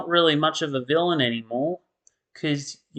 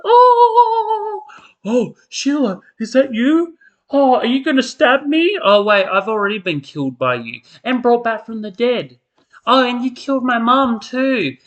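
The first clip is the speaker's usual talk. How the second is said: high, loud melodramatic squeal; mock soap heroine; 9 semitones higher, 5 dB louder than usual. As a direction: overplay the frightened soap heroine. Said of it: "It's like".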